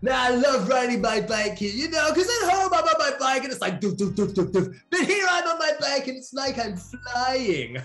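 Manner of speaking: high, excited mock Ukrainian accent; hammed-up Ukrainian accent, full of delight; imitated Ukrainian accent. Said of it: "That's how he speaks".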